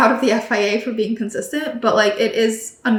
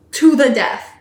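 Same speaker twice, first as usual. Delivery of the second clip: usual speaking voice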